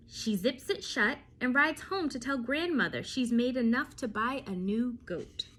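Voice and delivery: plain reading voice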